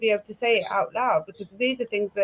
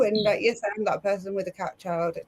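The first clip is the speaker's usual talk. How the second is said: usual speaking voice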